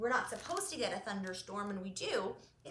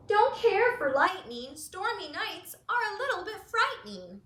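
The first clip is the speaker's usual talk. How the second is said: higher, louder fretful goose voice; nervous goose; 7 semitones higher, 7 dB louder than usual. Acted. Goose tells friends storms frighten it, fretful. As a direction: sound a bit scared of lightning. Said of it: "said goose".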